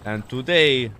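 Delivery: slow, loud Maltese cop voice; broken English, Maltese accent. Pretend. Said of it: "that's like a Maltese accent".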